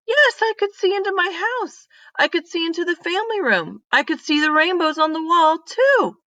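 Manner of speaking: the mystery just cracked open; excited discovery. Acted.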